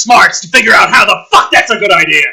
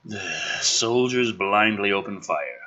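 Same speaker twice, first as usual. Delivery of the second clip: lower, softer trailer voice; low ominous narration; 9 semitones lower, 11 dB quieter than usual. Acted. Reading the father's arrival from the script, low narration. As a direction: murmur the scene with menace. Reads screenplay action aloud.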